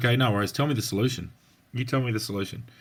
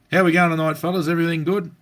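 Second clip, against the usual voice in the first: lightly voiced cop greeting, pitched up and louder; friendly copper; 5 semitones higher, 4 dB louder, faster than usual. Copper turning up to check on young blokes, lightly imitated friendly greeting. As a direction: call out a casual check-in like a local copper. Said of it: "the copper would turn up and go".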